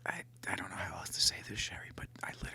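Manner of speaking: fast, hushed confession; whispering. Pretend. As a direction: whisper it out, flustered.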